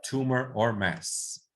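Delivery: "tumor or mass" stretched out slowly; slow and clear so they can repeat; slow recap drill. Shown as a demonstration. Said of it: "cualquier término médico con oma". (repaso lento y claro)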